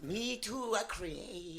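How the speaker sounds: high, soft mock Korean voice; Korean accent. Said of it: "Don't talk in a Korean voice".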